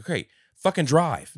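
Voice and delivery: angry. Retold or imitated. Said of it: "He's like".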